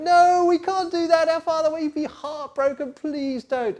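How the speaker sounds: high, loud pleading panic; frightened brothers. Acted. Brothers refusing Joseph's demand, high and frightened. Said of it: "And they went".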